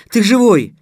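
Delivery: high, loud joyful cry; overjoyed relief. A narrator's reading. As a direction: exclaim it in amazed relief. Girl finds him alive.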